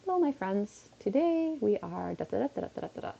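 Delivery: lightly voiced cheerful greeting; a hint of TikToker Linda's sing-song hello; lightly imitated sing-song TikTok opener; imitating Linda. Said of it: "everything she does starts with".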